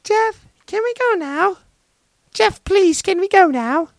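put-on tiny falsetto child voice, extremely high; little sister. Little sister begging her brother to leave, squeaky falsetto. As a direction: squeak it like a pleading five-year-old. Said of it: "his little sister started tugging on his arm".

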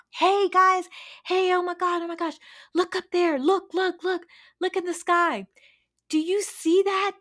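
high, loud, eager shouts of look up there; a young bird bursting with discovery; excited eagle calling out. Retold or imitated. Eagle calling his chicken friends to look, excited and high.

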